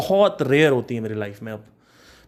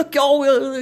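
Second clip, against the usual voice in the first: eager question; 10 semitones higher than usual. Retold or imitated. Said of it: "भाई कहता".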